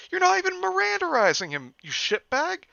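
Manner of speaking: very high, loud indignant yell; yelled in disbelief. Acted. Jeff yells at the cop as Liu is taken, high and loud. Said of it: "Jeff yelled out in disbelief".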